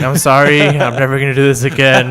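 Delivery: loud, hammed-up tearful apology; mock dramatic plea. Drunk teen writing a goodbye letter, hammed-up loud plea.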